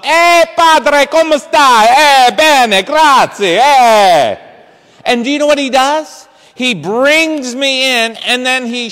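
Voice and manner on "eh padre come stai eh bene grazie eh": put-on loud, higher Italian accent greeting; hammed-up Italian host welcoming the padre; Italian maitre d' loudly greeting the priest. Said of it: "The maitre d' opens up".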